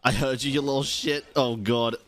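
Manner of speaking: normal talking voice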